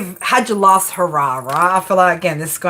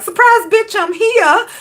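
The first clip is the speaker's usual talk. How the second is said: high squealed surprise, bitch; mock surprise; 12 semitones higher, 4 dB louder, slower than usual. Ex showing up unannounced, squealing high. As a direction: pop in squealing high and bright.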